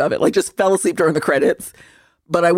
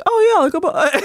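British interview voice; 8 semitones higher than usual. Retold or imitated. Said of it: "they'll be doing an interview".

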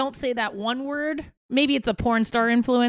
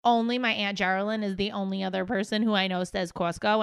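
ordinary talk

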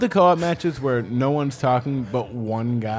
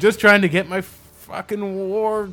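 annoyed gamer; 6 semitones higher, 5 dB louder, slower than usual. Retold or imitated. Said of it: "they're like".

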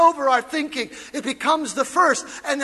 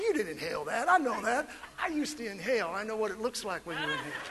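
much softer, amused retort; amused aside; 5 semitones lower, 13 dB quieter, faster than usual. Retold or imitated. Pastor to movie smokers, soft amused. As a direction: call their bluff gently. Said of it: "I said".

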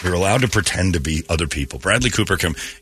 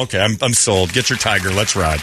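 usual speaking voice

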